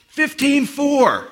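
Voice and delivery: slow, high, loud drawn-out call; proud time call. Retold or imitated. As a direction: announce the time like a trophy. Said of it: "and he goes".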